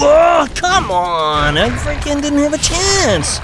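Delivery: high, drawn-out gamer outcry; real exasperated whine at the game; frustrated protest. Real, not acted.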